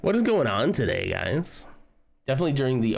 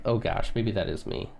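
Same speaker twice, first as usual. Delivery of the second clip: ordinary talk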